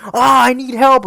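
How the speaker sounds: high, loud drawn-out cry for help; mock yelling. Prankster yelling for help in a store, mock yelling. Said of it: "he would start yelling".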